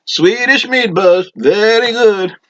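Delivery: high, slow, gushing fan voice; eager audience member. Acted. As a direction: pitch it way up like an eager fan in the crowd. Audience member's catchphrase again.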